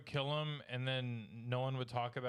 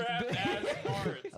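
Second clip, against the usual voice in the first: high giggling repeat; laughing; 9 semitones higher, 4 dB louder, much slower than usual. Real, not acted. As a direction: laugh the words out.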